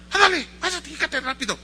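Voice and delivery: high-pitched hurried order; acted brusque confessor; impatient priest. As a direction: shoo a child along. Priest hurrying children along.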